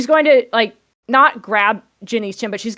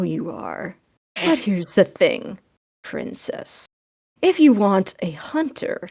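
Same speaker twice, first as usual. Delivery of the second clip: sultry teasing; 2 semitones lower, 4 dB quieter than usual. Acted. Horizon leans close, teasing Ginny in a hushed voice. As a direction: tease her softly, slowly. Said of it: "leans in a little and goes".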